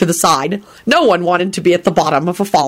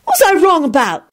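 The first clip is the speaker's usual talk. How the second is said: higher-pitched, mildly irked question; irritable; 10 semitones higher than usual. A narrator's reading. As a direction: demand it a touch crossly. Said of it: "she said irritably".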